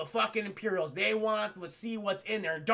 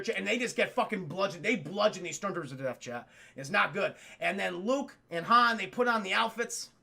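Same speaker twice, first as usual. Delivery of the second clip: ordinary talk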